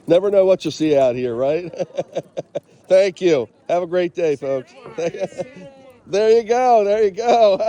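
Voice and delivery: high, laughing friendly call; laughing cheerful greeting. Real, not acted. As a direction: chuckle while greeting strangers.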